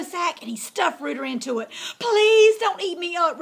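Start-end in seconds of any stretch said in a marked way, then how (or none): 2.0-3.4 high, loud desperate plea; pleading. Acted. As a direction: beg for your life. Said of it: "Rooter pleaded".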